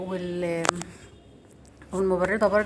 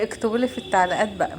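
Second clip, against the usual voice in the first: ordinary delivery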